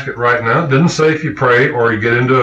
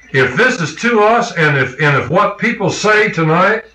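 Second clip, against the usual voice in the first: raised, ringing preacher's voice, pressing the point; fervent preaching; 5 semitones higher than usual. Acted. Preacher urging his congregation to claim the promise, fervent and raised. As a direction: drive it home to the congregation. Preaching rising to full force.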